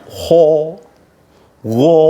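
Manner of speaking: "ho" and "go" with the lips pushed forward; wrong rounded kha. Shown as a demonstration. Teacher demonstrates a wrong, rounded pronunciation. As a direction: show the mistake of pouting the lips. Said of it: "bibirnya jangan dikumpulkan ke depan".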